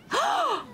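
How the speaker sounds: sharp gasp jumping high; high shocked gasp. Acted. Streamer gasping at the rude cat, high gasp.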